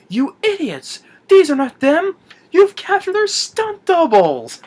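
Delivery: very high, loud, hammed-up outrage; mock furious yell. Retold officer scolding his guards, mock furious. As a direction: berate them, drawing out the final words.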